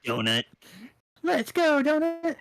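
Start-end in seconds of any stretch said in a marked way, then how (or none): 1.2-2.3 very high-pitched mock cheer; playful battle cheer. Cheering on a Pokemon named Donut, high and playful. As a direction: squeal it like a trainer urging on a pet.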